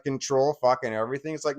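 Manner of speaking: ordinary talk, nothing marked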